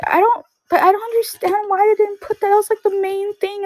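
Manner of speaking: very high-pitched imitation of an upset little boy; frustrated kid. Little cousin insisting they cut the main thing, frustrated. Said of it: "all frustrated".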